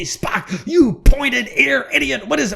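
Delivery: hammed-up Kirk blowing his top; furious Captain Kirk. Kirk yelling at Spock as the planet blows up. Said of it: "And say".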